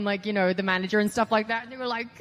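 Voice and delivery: ordinary delivery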